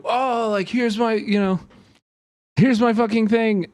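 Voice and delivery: high, mocking impression of a smug reporter. Mocking the reporter who started a stand-up mid-fight, high and snide. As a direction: sneer it up high like a pleased showman. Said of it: "starts doing a stand-up and is like".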